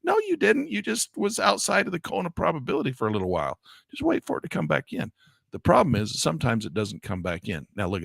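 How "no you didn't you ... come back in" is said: high, exasperated no; a touch of scolding disbelief; exasperated correction. Real, not acted. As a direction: set the nervous trader straight. Scolds the imagined trader.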